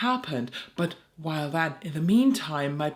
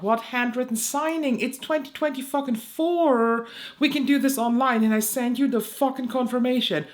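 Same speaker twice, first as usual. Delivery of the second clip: higher, exasperated protest; outraged retort; 6 semitones higher, 4 dB louder than usual. Retold or imitated. Creator recounting her email reply to DHL, outraged. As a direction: retell it with mounting indignation.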